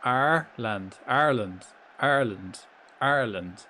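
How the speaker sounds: Ireland said ar-land, two syllables; teaching pronunciation. Shown as a demonstration. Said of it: "Repeat after me".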